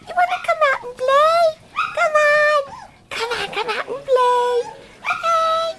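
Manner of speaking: high, fast coaxing sing-song. Real, not acted. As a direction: coax the puppy out, up high. Coaxing puppy out to play.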